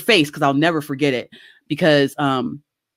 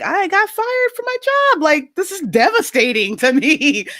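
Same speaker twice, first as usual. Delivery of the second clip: high, slow wail of despair; hammed-up teenage sobbing; mock crying; 12 semitones higher than usual. To mom, wailing about being fired. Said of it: "Why are you crying?".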